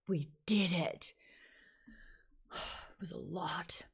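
soft, sighing and slow; tired relief. Real, not acted. Book club host, relieved they finished, sighing softly. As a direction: let it out with a weary sigh.

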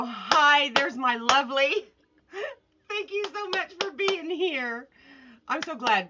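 real high, loud squeal of delight; excited greeting. Real, not acted. Streamer welcoming a favourite viewer to the live, excited. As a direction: greet them high and excited, stretching out the thanks.

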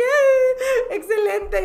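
high, loud, teary joy; excited. Retold or imitated. Retelling her joy at the repost, teary excited squeal. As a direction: squeal it out happily. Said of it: "Yo estaba de que".

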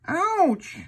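high squeaky pained ouch; mock ouch. Uncle voicing the hurt dog, high playful ouch. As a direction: cry out like a hurt puppy. Elephant trips over trunk.

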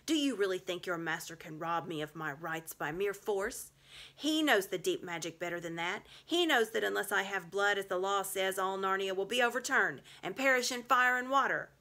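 loud menacing witch voice; savage witch. A narrator's reading. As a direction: build to a threatening crescendo. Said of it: "a savage smile that was almost a snarl".

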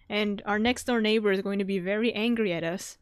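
ordinary talk, nothing marked

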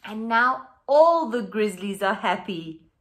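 animated picture book read-aloud; reading to children. A narrator's reading. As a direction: read it out lively for the class.

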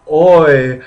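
a mock learner's loud Oi; mock English student. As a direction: play a keen English student saying Oi. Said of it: "English people studying ancient Greek and saying".

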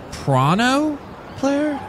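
slow, high puzzled question; drawn-out confusion. Acted.

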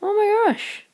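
high, loud cry of disbelief; a gamer's high-pitched exasperated cry; frustrated outburst. Real, not acted. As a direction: yelp it at the missed shot. Player frustrated at missing.

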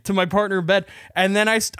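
ordinary delivery

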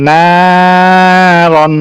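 "নারন" with a long held "না" and short "রন"; stretched madd. Shown as a demonstration. Teacher repeating the word for students, "না" stretched. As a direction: sustain the first vowel of "নারন" on a level note. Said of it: "নাকে খালি টানলাম".

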